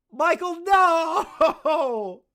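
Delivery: drawn-out laughing cry of no. Real, not acted. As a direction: stretch it out while laughing. Laughing disbelief at Michael.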